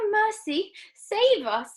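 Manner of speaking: very high, loud cry; yell. A narrator's reading. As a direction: yell for help. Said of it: "yelled Missus Beauregard".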